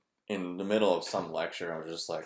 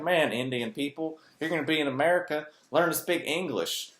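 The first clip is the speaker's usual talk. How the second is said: mock ignorant local; 9 semitones higher, 6 dB louder than usual. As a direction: parody a bigot ranting, hammed up. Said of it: "I'm like".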